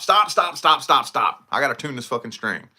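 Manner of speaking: halting the band. Retold or imitated. Stopping his band to tune a string, loud and commanding. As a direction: bark at the band to stop playing. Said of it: "I would make everybody fucking stop".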